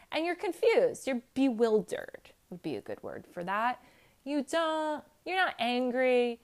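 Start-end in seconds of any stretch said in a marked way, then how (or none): none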